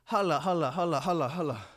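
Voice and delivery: panting between words; breathless after celebrating; out of breath. Real, not acted. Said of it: "I'm out of breath".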